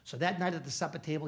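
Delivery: usual speaking voice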